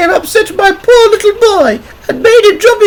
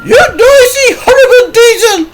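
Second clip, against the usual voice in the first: very high, loud angry mother voice; outraged mum telling someone off; cross shout; 7 dB louder than usual. A narrator's reading. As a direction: shout it crossly in a piercing falsetto. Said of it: "she shouted crossly".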